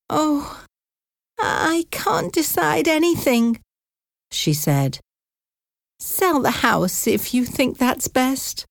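high, quavering worried aunt voice; an elderly aunt scared of losing her home; frightened old aunt. Acted. Aunt Sarah fearing she must leave, high and frightened.

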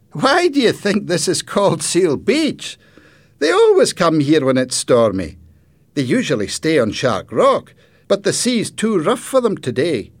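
put-on high, loud boy's voice; friendly boy character. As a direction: explain eagerly as the local boy. Boy explains the seals.

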